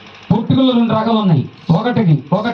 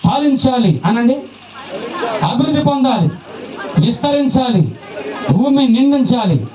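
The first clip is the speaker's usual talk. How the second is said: fervent preaching; 4 semitones higher than usual. Acted. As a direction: proclaim each blessing to the crowd. Preaching blessings at full force.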